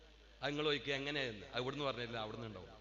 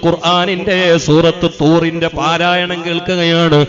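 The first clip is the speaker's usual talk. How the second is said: slow, much louder chanted sermon praise; a drawn-out, rousing sermon chant; full-force preaching; 26 dB louder, slower than usual. Acted. Chanted sermon tells Umar story.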